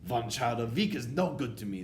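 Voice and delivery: imperious witch; demanding cry. A narrator's reading. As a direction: cry out as a dissatisfied witch boss. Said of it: "the Grand High Witch cried out".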